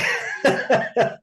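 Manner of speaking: breathy high shrieking laugh; giddy laugh. Real, not acted. Singer recalling meeting his idol, giddy high laugh. Starstruck laugh after Oh my God.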